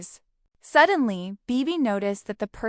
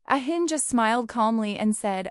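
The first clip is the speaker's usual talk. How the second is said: ordinary delivery, nothing marked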